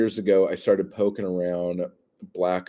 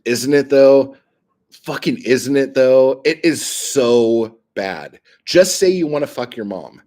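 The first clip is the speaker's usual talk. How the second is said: loud ranting; 4 semitones higher, 8 dB louder than usual. Real, not acted. Ranting about a term.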